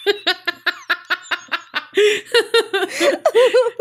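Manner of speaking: high, loud long laughter. Real, not acted. Cohost laughing hard at her own joke, loud.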